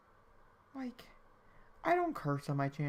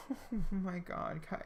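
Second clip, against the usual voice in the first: normal talking voice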